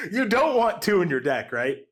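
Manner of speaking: high, laugh-choked words; cracking up in disbelief; laughing while talking. Real, not acted. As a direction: struggle to talk through real laughter. Cracking up at second Upheaval.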